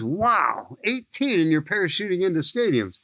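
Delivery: a mild mock amazement from a veteran broadcaster; announcer marveling at the teen skydiver, lightly impressed; Jack Buck marveling. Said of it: "And he goes".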